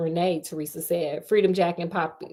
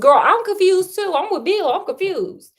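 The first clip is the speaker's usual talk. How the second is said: high, louder exasperated comment; exasperated confusion; 10 semitones higher, 4 dB louder than usual. Real, not acted. Host siding with Bill, high and exasperated. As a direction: throw up your hands in disbelief.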